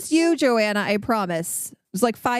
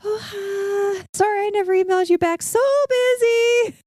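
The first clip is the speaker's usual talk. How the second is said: high, flustered fake-cheery greeting; awkward flustered self; 12 semitones higher than usual. Retelling meeting Joanna in the park, high and flustered. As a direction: play your past self caught out, chirpy and panicked.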